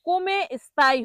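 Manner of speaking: কোমে স্তাই with "me" and "tai"; careful model কোমে স্তাই, "ai" ending; correct pronunciation. Shown as a demonstration. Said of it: "হবে".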